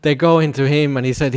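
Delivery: ordinary delivery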